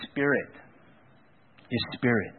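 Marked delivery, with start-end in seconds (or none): none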